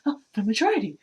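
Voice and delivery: grasp at it like a lifeline; clinging to hope. Retold or imitated. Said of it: "he's holding on to whatever strand".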